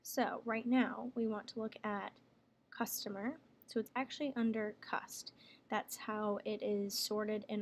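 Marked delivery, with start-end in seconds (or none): none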